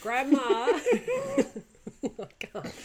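talking through laughter; can't stop laughing. Real, not acted. Cracking up over grandma scene.

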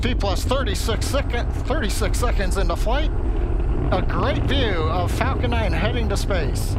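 excited live play-by-play; live launch commentary. Real, not acted. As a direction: call the launch live, upbeat.

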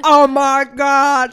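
put-on loud, long drawn-out drunk rave; drunken raving. Tipsy friends raving over the best food ever, loud and drawn out. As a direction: stretch it out at full volume, overawed.

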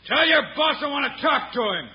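loud high call across a yard. Acted. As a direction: call out to someone far off. Calling out to distant gunman.

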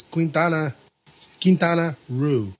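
"Quintana Roo" with a gap before Roo; said as two words. Shown as a demonstration. Correcting a cat's name from the city, "Quintana Roo" as two slow words. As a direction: say "Quintana", then a separate, drawn-out "Roo". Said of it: "well, it's two words".

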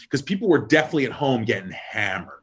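usual speaking voice

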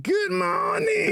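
high, slow, shouted good morning; imitating alarm clip. Imitating the girlfriend's alarm clip greeting. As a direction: stretch it out like a cheery wake-up call. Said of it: "The good morning clip".

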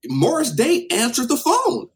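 high, loud, drawn-out astonishment; amazed storyteller's exclamation. Real, not acted. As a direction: land the punchline in slow, loud disbelief. Amazed punchline of own story.

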